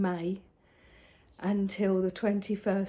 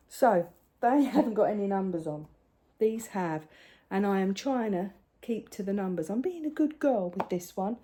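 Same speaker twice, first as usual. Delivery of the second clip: ordinary delivery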